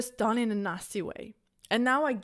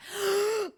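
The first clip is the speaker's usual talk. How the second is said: mock gasp; 11 semitones higher than usual. Mocking her past spiritual self.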